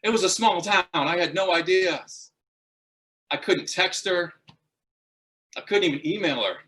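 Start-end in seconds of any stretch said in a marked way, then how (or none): none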